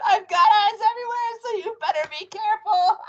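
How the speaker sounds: very high, gloating witchy voice; cackling. A narrator's reading. Wife warning husband she sees everything, cackling. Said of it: "cackled Missus Twit".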